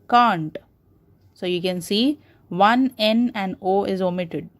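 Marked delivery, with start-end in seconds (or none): 0.1-0.6 "can't" as one syllable with a long ah; contracted form. Shown as a demonstration. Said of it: "contracted form of cannot is".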